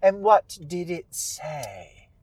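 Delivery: drawn-out, grand questioning; Old Widow Holcomb. Acted. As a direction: probe slowly, in theatrical witch voice. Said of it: "And Holcomb says".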